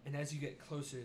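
normal talking voice

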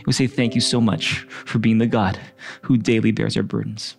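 gentle worship-leader prayer. Acted. Leads a prayer over music.

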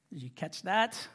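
normal talking voice

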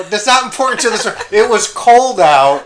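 loud, high insistence; exasperated. Real, not acted. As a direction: override the nitpick, rushed then stretched out. Exasperated at co-host's nitpicking.

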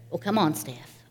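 lightly voiced gruff grandfather; gruff grandpa. Grandfather calling his granddaughter, low and gruff. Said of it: "in that gruffy voice".

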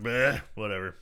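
low, bored brush-off; dismissive. Retold or imitated. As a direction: shrug it off. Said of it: "I was just more of a rogue".

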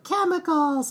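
imitating kids. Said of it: "they were like".

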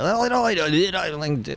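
imitated working-class Geordie, high and garbled; drunken Geordie accent. Roommate slipping out of the Queen's English, drunken Geordie accent. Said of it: "he would slip out of, like, the Queen's English".

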